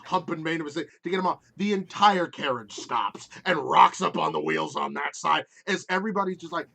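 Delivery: usual speaking voice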